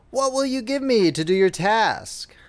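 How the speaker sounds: lightly voiced little man, high start; a droll little man greeting the girl; hobgoblin voice. Hobgoblin asks the weeping miller's daughter, lightly high voice. Dwarf's voice in story.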